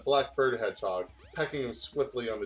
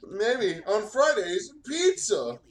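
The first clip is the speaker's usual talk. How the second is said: higher, slow hammed-up announcement; mock announcer; 9 semitones higher, 5 dB louder, much slower than usual. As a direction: stretch it out like a silly host. Jokingly continues the mock introduction.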